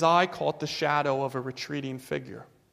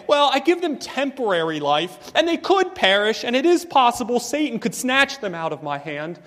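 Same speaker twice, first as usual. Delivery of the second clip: mock wrong version; 8 semitones higher, 6 dB louder than usual. Preacher mocking a false teaching, pitched high and scornful. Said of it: "Jesus does not say".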